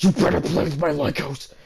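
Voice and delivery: imitating a bully's loud threat; threatening shout. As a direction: yell it like a bully.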